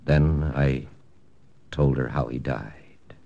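low, hushed voice-over narration; story narration. A narrator's reading. Hero telling the listeners how he broke the news, low narration. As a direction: narrate it like a memory, low and inward.

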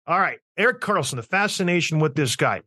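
ordinary talk, nothing marked

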